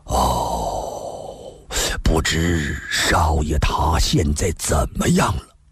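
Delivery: long low sigh, then slow wistful wondering; low, drawn-out worry for someone absent; worried sighing. Acted. Tie Chuanjia wonders about his young master, sighing with worry.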